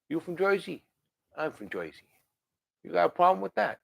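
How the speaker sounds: put-on light New Jersey wise guy accent, tough-guy swagger; a lightly done New Jersey wise guy, daring them to argue. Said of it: "say it with a New Jersey wise guy accent".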